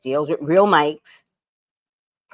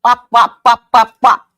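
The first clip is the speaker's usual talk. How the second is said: loud wop chant; 6 semitones higher, 13 dB louder than usual. Real, not acted. Showing off new mic.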